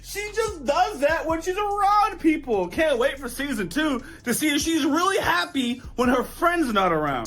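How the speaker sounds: high, hammed-up weepy wail; mock tearful. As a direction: keep up the exaggerated crying act. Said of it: "I was in tears, man".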